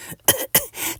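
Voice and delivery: high, breathless little coughs; tiny cough. Acted. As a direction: cough out the dust squeakily. Itch coughing in dust.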